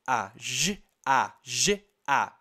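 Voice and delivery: "A, G, A" soletrados baixo, letra por letra; sons soletrados. Shown as a demonstration. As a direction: soletre "A, G, A" mais grave, como a criança ouve. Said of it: "Porque ela tá ouvindo G". (letras "A, G, A" ditas separadas e graves)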